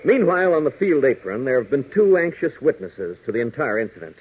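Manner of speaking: ordinary talk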